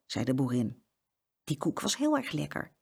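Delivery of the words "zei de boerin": ordinary delivery, nothing marked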